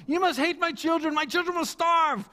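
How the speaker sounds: high, melodramatic mock lament; excited haggler. Merchant guilt-tripping a bargaining customer, excited and melodramatic. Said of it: "He gets so excited".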